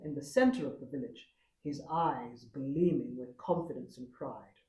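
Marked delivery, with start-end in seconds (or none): none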